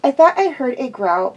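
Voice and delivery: ordinary delivery, nothing marked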